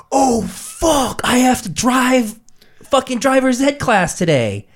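high, slow, dragged-out panic; high drawn-out panic; panicked whine. Retold or imitated. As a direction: whine it like a busted teen. Panicked self-quote, teacher arrived.

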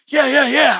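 hammed-up eagerness; over-eager. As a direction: jump at it like an eager volunteer. Acting out his eager inner self.

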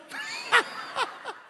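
real high-pitched cackle. Real, not acted. Preacher enjoying his own punchline, cackling laugh. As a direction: burst out laughing at your own story.

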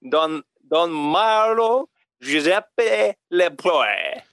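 slow, drawn-out mock French accent on "Don Marlo Giuseppe Lepoe". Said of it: "read it like it's, like it's a French name".

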